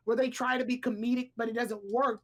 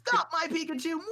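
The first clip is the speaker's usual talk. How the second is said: mock cartoon voice; 7 semitones higher than usual. Repeating cartoon line in voice.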